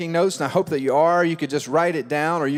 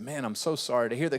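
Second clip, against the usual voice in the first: soft, lower, comforting; sympathetic pastor; 5 semitones lower, 8 dB quieter, faster than usual. Retold or imitated. Pastor consoling the mocked teen, soft and sympathetic. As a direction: comfort him gently. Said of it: "I said".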